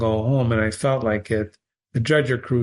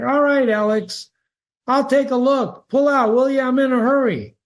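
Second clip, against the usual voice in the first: hurried doctor; 13 semitones higher, 4 dB louder than usual. Acted. Doctor calling back in a hurry.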